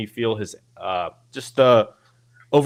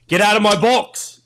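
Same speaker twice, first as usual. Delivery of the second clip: loud, high commanding bark; authoritative command; 11 semitones higher, 8 dB louder than usual. Retold or imitated. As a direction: order them off the box. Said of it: "by speaking louder, more authoritative, and deeper".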